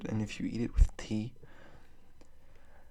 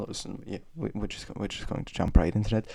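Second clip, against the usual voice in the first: ordinary talk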